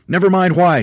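high, loud impatient shout; Mugglewump shouting. A narrator's reading. Monkey leader silencing questions, shouting. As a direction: cut them off with a shout. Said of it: "shouted Mugglewump".

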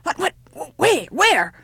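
high, stammering panic; alarmed cry. Acted. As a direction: cry out as if a wolf were near. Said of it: "cried the old woman, expecting a wolf".

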